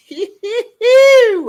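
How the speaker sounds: a high, loud shrieking laugh. Real, not acted. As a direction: let out a big shrieking laugh.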